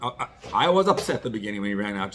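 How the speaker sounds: normal talking voice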